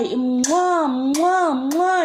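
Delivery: loud, high mock kiss sounds; imitated kisses. Imitating her kissing at me, loud high mwahs. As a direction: smack out exaggerated spoken kisses. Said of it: "she's saying all these things".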